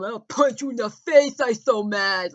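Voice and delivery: sneering impression shouted loud; mocking the neighbor. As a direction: play her as a childish, raging brute. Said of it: "when I saw you go".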